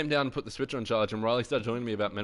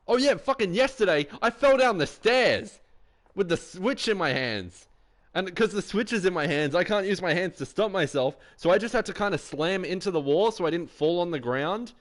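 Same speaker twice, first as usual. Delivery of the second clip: excited outburst; 8 semitones higher, 5 dB louder than usual. Real, not acted. Bursting out about falling downstairs.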